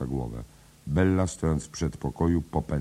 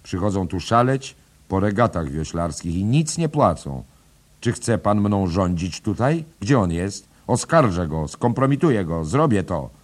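loud, higher furious threats; angrily; 5 semitones higher, 8 dB louder than usual. A narrator's reading. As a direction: spit out the threats. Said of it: "Bella z gniewem".

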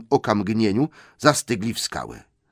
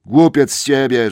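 higher, louder troll insult; 6 semitones higher, 9 dB louder than usual. Acted. William insults Tom loudly. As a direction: spit the insult at him. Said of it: "rzekł William".

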